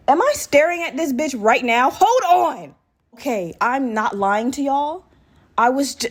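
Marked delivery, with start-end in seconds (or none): 0.1-2.7 real shock, voice jumping up; startled disbelief. Real, not acted. Sees her missing snake.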